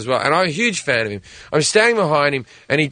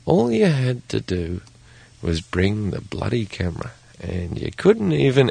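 gritted-smile scolding; 4 semitones lower than usual. Retold or imitated. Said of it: "went up with a gritted smile".

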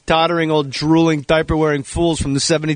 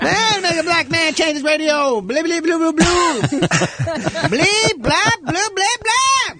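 very high, squealing gibberish impersonation; Chris Tucker; 13 semitones higher, slower than usual. Acted. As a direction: squeak nonsense syllables at a high pitch. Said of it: "my impression of Chris Tucker".

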